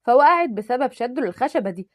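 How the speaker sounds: ordinary delivery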